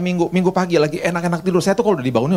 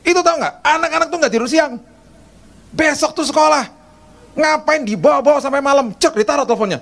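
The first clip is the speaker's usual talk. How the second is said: much higher, sharp reproach; scolding tone; 12 semitones higher than usual. Retold or imitated. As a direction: scold like a strict nanny. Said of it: "Ngomongnya gini, dengan nadanya gini nih".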